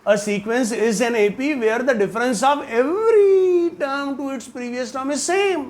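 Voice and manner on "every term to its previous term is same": "every" stretched out long and high; stress on every. Real, not acted. Teacher correcting students on the definition, stress on every. Exasperated repeat, hammering the definition.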